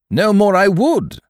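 very high, loud, drawn out; squire's indignant cry. A narrator's reading. Squire cutting in on the captain, indignant cry. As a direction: burst out in indignation. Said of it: "cried the squire".

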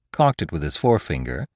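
usual speaking voice

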